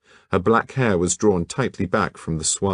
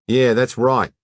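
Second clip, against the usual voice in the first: put-on loud, higher broad Sydney accent; broad Australian accent; 6 semitones higher, 5 dB louder than usual. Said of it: "His Sydney accent was so broad".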